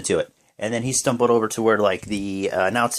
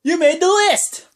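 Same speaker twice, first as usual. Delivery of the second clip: much higher, loud mock shout; Jericho catchphrase; 6 dB louder than usual. Jericho adding a name to his list, hammed-up loud shout. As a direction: bellow it like a gleeful showman. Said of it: "He goes".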